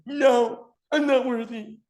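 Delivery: high-pitched, anguished refusal; hammed-up voice of inner doubt; protesting subconscious. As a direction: wail it as mock self-doubt. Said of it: "Subconscious says".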